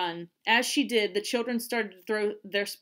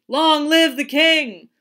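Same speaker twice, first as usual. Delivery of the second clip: loud, slow, high acclamation; drawn-out ceremonial shout; crowd's cheer; 6 semitones higher, 13 dB louder, slower than usual. A narrator's reading. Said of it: "The crowd responded".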